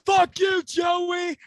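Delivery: high-pitched loud heckle yelled at a passing driver; big loud scream. Retold or imitated. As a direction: scream it out across a crowd. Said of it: "I scream a big loud".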